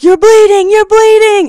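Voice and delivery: high, loud imitation of panic; retold frantic alarm; alarmed cry. Retells friend's alarmed cry while bawling.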